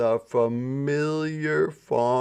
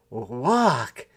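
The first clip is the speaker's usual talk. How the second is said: whisper. Acted. City Mouse scoffs, whispered.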